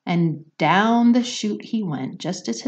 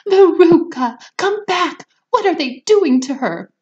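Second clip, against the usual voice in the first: high, loud frantic calling; mother screaming after her daughter; panicked screaming; 12 semitones higher, 9 dB louder than usual. Acted. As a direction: shriek after the daughter being dragged off. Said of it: "screamed Missus Salt".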